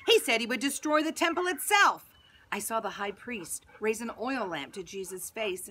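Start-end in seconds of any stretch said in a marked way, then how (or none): 0.1-2.1 crowd screaming. Acted. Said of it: "People were screaming".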